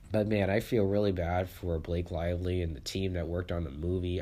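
ordinary talk

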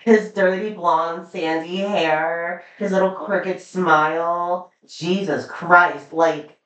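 slow, drawn-out swooning over a crush; smitten gushing. Real, not acted. Fan gushing to a co-host about an actor crush, swooning. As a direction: linger on each feature, helplessly smitten.

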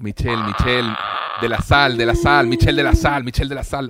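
calling out a name. Pretend.

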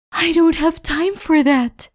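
desperate Spirit. Acted.

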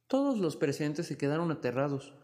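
ordinary delivery, nothing marked

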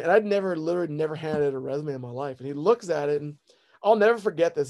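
normal talking voice

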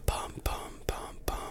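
whispering the jingle tune; whispered jingle. Pretend. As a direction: breathe the bum bums softly.

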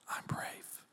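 put-on voiceless whisper, as a scared child. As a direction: breathe the words softly into an ear. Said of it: "whisper it in my ear as quietly as you can".

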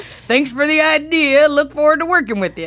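cartoon executive. Imagined cartoon executive hands money.

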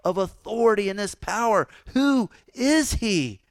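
amazed crowd. Retold or imitated. Preacher voicing the amazed crowd about Jesus, high and loud. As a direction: marvel out loud at the stranger. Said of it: "and they said".

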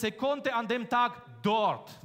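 "dort" am Satzende langsam betont; Betonung auf dort. Shown as a demonstration. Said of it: "Betonung auf dort setzen". (Betonung auf "dort")

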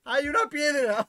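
slow, high, loud alarmed shout; girl shouting. Retold or imitated. Imitating a little girl at the beach, a slow, high alarmed shout. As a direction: yell out the warning to the whole beach. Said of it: "Y empezó a gritar".

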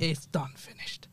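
dropped to a near whisper; quiet. Real, not acted. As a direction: let it fade out softly. Man's story wrapped up fast.

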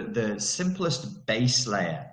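ordinary delivery

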